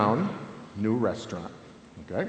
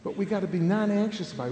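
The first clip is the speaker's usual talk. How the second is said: usual speaking voice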